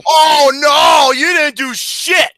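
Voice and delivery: high, loud sarcastic shout; outraged retort. Real, not acted. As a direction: shout back, stretching the words.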